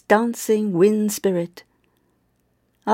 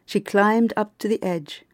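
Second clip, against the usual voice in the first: ordinary delivery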